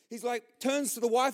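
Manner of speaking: ordinary delivery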